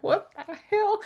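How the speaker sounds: high, shocked squeak of disbelief; mock shocked Sam. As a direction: squeal it like a stunned teen. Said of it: "And Sam's like".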